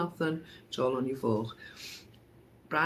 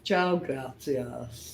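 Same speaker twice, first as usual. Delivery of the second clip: mock Italian; 4 semitones lower, 4 dB quieter, much slower than usual. Mixing Italian and Spanish jokingly.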